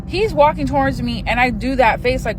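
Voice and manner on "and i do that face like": ordinary talk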